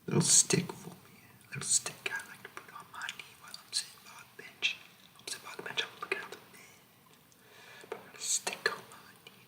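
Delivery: menacing drill sergeant in a soft whisper; creepy whispering sergeant. Acted.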